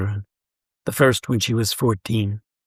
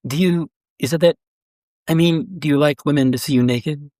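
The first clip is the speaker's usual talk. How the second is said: halting, higher-pitched, feeling for the words; start, stop and restart, pitched up; hesitant question; 4 semitones higher than usual. A narrator's reading. Woman asks the naked man, hesitant and awkward.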